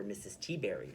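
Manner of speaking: ordinary talk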